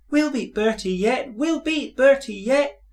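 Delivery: very high, loud echoing coaches; high excited chant; eager echo. A narrator's reading. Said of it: "echoed Annie and Clarabel".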